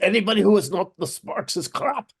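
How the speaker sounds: a quick mock Scottish brogue; Scottish accent. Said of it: "That's my Scottish accent".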